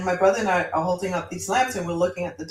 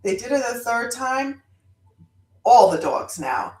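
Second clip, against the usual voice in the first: ordinary delivery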